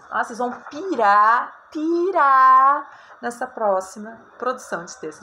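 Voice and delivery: loud, high and eager, teasing the class; excited tease. Real, not acted. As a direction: build hype for the next task. Teasing next assignment. (empolgado e alto)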